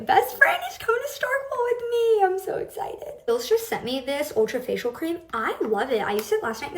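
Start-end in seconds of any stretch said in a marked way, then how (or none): 0.1-3.1 excited squeal. Real, not acted. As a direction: gush with excitement. Excited about friend visiting.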